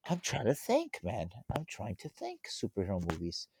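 very soft, trailing mutter to himself; thinking aloud. Real, not acted. Guest searching for superhero movies, thinking aloud quietly. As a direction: murmur it while searching your memory.

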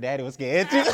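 sheepish admission breaking into laughter; real laugh after the secret; laughing confession. Real, not acted. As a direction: own up, then crack up.

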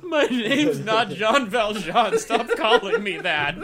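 loud, high, slow laughing rant; hammed-up outraged actor; Russell Crowe impression.

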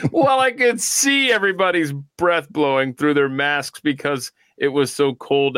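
words broken by laughter; laughing. Real, not acted. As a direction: read it while laughing. Laughing while reading post.

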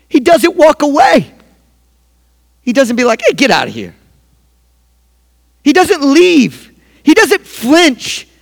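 full preaching. Acted. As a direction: preach it with rising fire. Preaching builds to full force.

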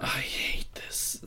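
slow, hushed despair; barely voiced, exasperated sigh of words; defeated whisper. Real, not acted. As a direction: whisper it slowly, utterly fed up. Weary disgust at the show.

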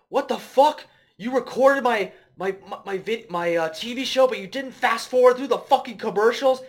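high, loud enraged yell; hammed-up tantrum at his mom; furious son yelling. Friend retelling movie, voicing the son screaming at his mom.

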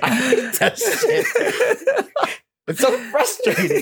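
high, squealing words through laughter; real helpless laughter. Real, not acted. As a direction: barely get it out while cracking up. Cracking up over boss frustration.

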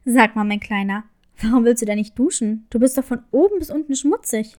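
low, kindly crab character; friendly crab voice. A narrator's reading. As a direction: speak as a gentle low crab. Crab speaks to Ming.

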